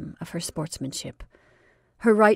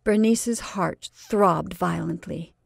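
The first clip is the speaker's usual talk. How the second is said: plain reading voice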